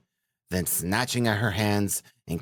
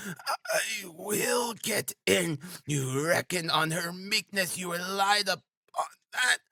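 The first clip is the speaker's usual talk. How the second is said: frenzied woman; 11 semitones higher than usual. A narrator's reading. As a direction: rage in a high, desperate woman's voice. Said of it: "in a frenzy".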